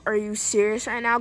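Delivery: normal talking voice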